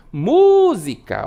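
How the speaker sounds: música with a long-held mú; calling out the word. Shown as a demonstration. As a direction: call out música, stretching the first syllable mú. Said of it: "O mu demora mais". (música chamada, com o mú alongado)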